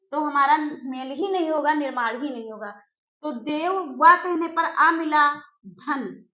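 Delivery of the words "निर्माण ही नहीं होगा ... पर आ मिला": ordinary talk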